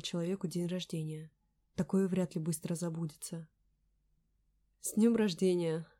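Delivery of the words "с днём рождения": ordinary delivery, nothing marked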